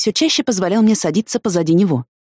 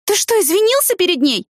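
very high, incredulous question; exasperated, voice pitched way up; scolding; 14 semitones higher than usual. A narrator's reading. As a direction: demand an answer in disbelief. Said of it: "прикрикнула я на Дэнни".

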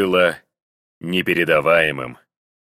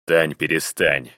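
curt husband; 3 semitones lower than usual. A narrator's reading. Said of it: "осадил он её".

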